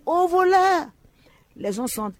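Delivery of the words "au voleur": high, loud cry of thief; shouting thief. Retold or imitated. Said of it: "Elle a crié".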